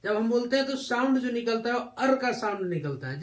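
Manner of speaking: ordinary talk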